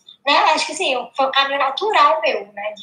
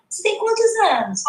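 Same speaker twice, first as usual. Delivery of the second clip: imitating little girl; 7 semitones higher than usual. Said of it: "Aí ela".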